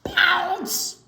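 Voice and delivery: sudden high loud burst; spring the word out; loud pounce. Acted. Shouted pounce to startle the children.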